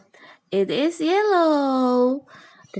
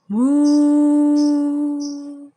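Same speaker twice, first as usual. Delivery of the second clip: cow moo; 4 semitones higher, 4 dB louder than usual. Pretend. Teacher imitating cow sound.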